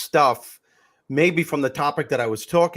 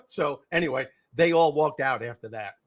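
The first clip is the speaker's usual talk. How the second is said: ordinary talk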